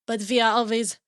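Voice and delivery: put-on French accent with halting fillers. Podcast host welcoming listeners in a mock French accent. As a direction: play a chatty French host. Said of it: "I started with this French accent".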